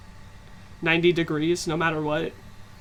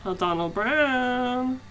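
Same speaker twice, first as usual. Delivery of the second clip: drawn out, high spooky call; slow spooky call; 5 semitones higher, much slower than usual. Pretend. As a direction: stretch the name slowly.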